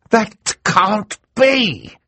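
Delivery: very slow, high outcry of disbelief; Mister Poe's protest. Acted. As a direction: protest it, stretched out. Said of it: "said a voice from the audience".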